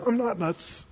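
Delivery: just state it, unbothered; calm denial. Shown as a demonstration. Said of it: "You can say it like that".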